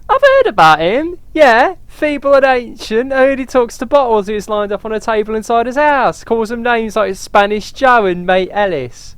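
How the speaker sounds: worried mother voice. Acted. Mother worrying the boy is affected, high voice. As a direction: fret about the boy in a high voice. Voicing gossiping character.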